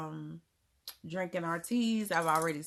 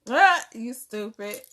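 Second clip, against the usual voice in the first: loud, slow "you stupid", laughing at a friend; playful loud tease; 5 semitones higher, 12 dB louder, slower than usual. Real, not acted. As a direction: yell it out as a joke, slowly. Teasing a viewer in chat.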